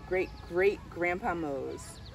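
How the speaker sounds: ordinary delivery, nothing marked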